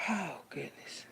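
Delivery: breathy whispered aside; hushed little gasp of a whisper. Real, not acted. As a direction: breathe it out under your breath. Unvoiced whisper at start.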